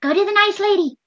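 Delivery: high-pitched coaxing little voice; high, urging aside into an ear; pretend whisper in the ear. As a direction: coax in a small, high stage-whisper voice. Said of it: "I'm gonna whisper in Kraken's ear".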